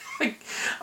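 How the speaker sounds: breathy suppressed laugh; a laugh slipping out; stifled laugh. Real, not acted. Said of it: "trying not to laugh".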